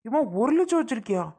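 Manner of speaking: high-pitched, astonished questioning. Acted. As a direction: ask it in rising disbelief at the hidden money. Son learns of mother's hidden money.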